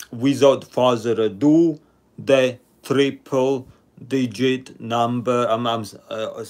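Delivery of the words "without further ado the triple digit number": slow, drawn-out announcement; deliberately slow. Shown as a demonstration. Stream host announcing the number, slow and deliberate. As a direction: stretch each word out for the stream delay. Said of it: "purposely talking slowly 'cause the eleven second delay".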